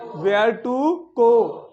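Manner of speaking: teacher dictating slowly; slow drill. Shown as a demonstration. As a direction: model it slowly for the class to repeat. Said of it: "आपको repeat करना है मेरे साथ".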